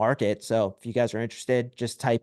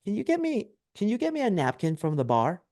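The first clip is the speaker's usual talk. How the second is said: higher-pitched imitation of a girl; girl asking a favor; 7 semitones higher than usual. Girl at a nightclub asking a favor, imitated girl's voice. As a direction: put on a girl's high, wheedling request. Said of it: "and the girl's like".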